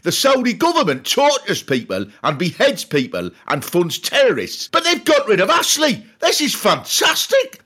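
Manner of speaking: rising into a high excited yell; mock fan yelling. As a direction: cheer the good news like a delighted fan. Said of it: "almost every fan is yelling".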